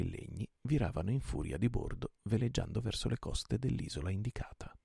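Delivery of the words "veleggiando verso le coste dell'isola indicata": ordinary delivery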